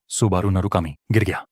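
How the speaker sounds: ordinary delivery, nothing marked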